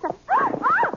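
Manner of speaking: sudden high gasp into a scream; gasp, then a high startled cry. Acted. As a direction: shriek as the horse bolts. Horse bolts under her.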